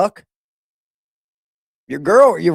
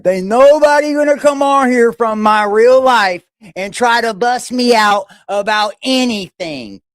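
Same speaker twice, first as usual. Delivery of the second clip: usual speaking voice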